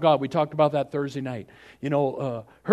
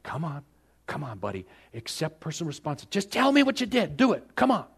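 urging. Acted. Voices God urging silent Adam.

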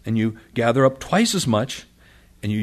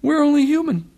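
much higher, helpless and exasperated; Moses; 14 semitones higher, 6 dB louder than usual. Acted. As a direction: throw up your hands, pitch way up. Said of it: "Moses says".

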